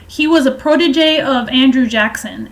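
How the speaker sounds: ordinary delivery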